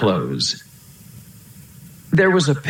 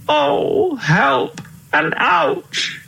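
high, drawn-out wail; high-pitched storybook moan of woe; distressed cry; much slower than usual. A narrator's reading. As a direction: wail for help, aching and lost. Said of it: "cried the king".

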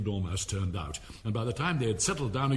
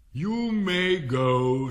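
very slow, louder and higher; royal dismissal; 6 semitones higher, 7 dB louder, much slower than usual. Acted. King dismisses the Hatter with a slow, grand wave-off. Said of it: "said the king".

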